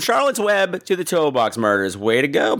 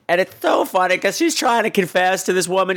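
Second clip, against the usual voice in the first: ordinary delivery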